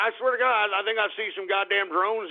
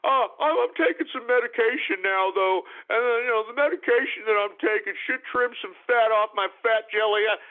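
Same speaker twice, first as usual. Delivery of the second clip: high, whimpering mock voice; fake-sob it like a self-pitying crybaby; mocking a whiny YouTuber; 4 semitones higher than usual. Host mocking Boogie's self-pity, whiny fake crying. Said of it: "sympathy moochers".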